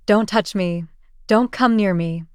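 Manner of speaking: plain reading voice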